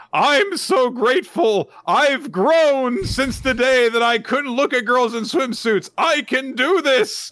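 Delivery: high, loud, slow mock-ecstatic shout; creepy triumphant yell. Gakuro ogling the girls, high slow ecstatic shout. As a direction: bellow your pride like a proud pervert. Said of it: "and he's like".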